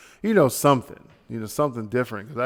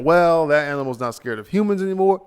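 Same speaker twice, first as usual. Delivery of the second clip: higher, louder mock explaining; mock know-it-all; 5 semitones higher, 5 dB louder, faster than usual. Quoting people who say the animal lost its fear, mock know-it-all. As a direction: quote them with a raised, insistent voice.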